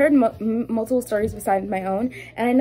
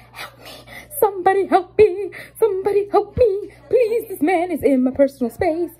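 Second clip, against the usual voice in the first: high, louder desperate cry for help; mock desperate plea; 9 semitones higher, 3 dB louder than usual. Cashier begging coworkers to rescue her from the customer, desperate cry. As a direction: plead like you're trapped.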